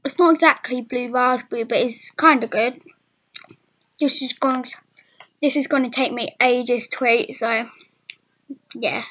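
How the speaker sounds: sucking on a hard sweet; words around a lozenge; talking with candy in mouth. Real, not acted. Seen 3: puts sweet in at -7; lips bulge, hand covers mouth while talking.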